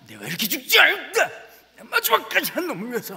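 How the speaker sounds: mock battle-hero bravado; mock heroic warrior. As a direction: ham up a dying action hero.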